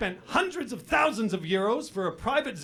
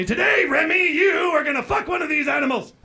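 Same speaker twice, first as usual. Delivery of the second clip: mock threat shout; 9 semitones higher, 8 dB louder than usual. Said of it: "I say".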